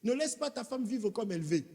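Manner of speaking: "veut" said the Congolese way, like "vè"; a Congolese accent breaks through mid-sermon; Congolese accent slipping out. Real, not acted. Preacher to church, "veut" slipping into a Congolese accent. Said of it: "c'est l'accent congolais qui est sorti".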